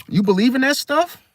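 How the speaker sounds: high, rising mock disbelief; incredulous scoffing. Doubter dismissing your intuition, high and scoffing. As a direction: question it high, dismissing the belief.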